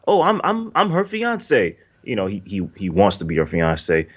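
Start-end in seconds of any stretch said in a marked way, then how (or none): 0.1-1.8 high-pitched, stammered claim, last words drawn out; imitated eager suitor. Suitor butting in to claim he's her fiance, high-pitched. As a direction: play a flustered would-be fiance, pitched up.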